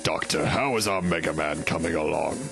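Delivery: low, stately royal tone; regal voice. Acted. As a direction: speak like a commanding monarch. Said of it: "I can never remember my regal voice".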